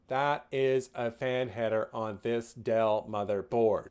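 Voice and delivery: usual speaking voice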